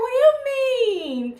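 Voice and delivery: high, loud, stretched-out what do you mean; emotional surprise. Retold or imitated. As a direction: cry out in hurt surprise. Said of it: "I was like".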